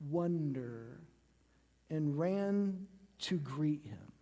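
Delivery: soft, slow and whispered; hushed awe. Acted. Preacher reading the crowd's amazement, whispered in awe. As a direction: breathe it out in wonder.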